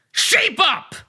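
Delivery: high, loud bellowed command; furious parting yell. Acted. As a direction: bellow it after him. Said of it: "I bellowed".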